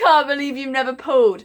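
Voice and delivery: mock laughing taunt of a boyfriend. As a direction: laugh it out teasingly.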